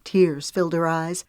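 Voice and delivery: plain reading voice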